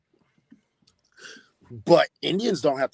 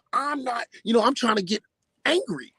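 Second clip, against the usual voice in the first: normal talking voice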